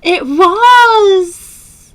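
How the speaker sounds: high, loud drawn-out agreement; emphatic exclamation. Real, not acted. Host confirming today was launch day, emphatic exclamation. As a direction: cry it out, stretching the last word.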